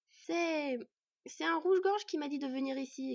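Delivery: high little voice, halting start; hesitant child. Acted. Boy explaining to the angry dwarf, high and hesitant. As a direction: stammer the first word, then explain.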